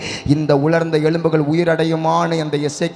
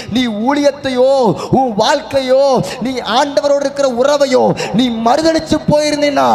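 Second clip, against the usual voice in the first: normal talking voice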